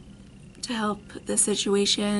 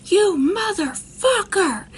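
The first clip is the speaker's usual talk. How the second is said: high, loud drawn-out mock yell; retold furious yell; 11 semitones higher, 5 dB louder than usual. Dad lunging at the boyfriend, retold furious yell. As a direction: act out an enraged attacker yelling.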